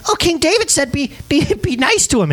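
high squeaky messenger voice, laughing through it; hammed-up high pleading relay; mock high voice. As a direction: raise the voice way up to mock the king's order. Messenger quoting David.

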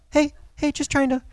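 high, soft little honk voiced as words; a gentle, high-pitched heads-up; polite horn beep. Preacher imitating a polite car horn, high and light. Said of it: "different horn beeps".

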